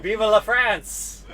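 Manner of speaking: loud, slow, high revolutionary shout; rallying cry. Pretend. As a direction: belt it out like storming the barricades. Mock revolutionary cry after laughter.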